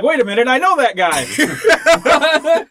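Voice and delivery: mock surprise. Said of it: "I thought you were gonna be like".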